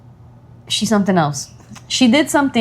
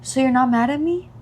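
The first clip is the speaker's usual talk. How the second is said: put-on higher child voice, rising question; imitated uncertain daughter; 5 semitones higher than usual. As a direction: check timidly as a little child. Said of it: "She's like".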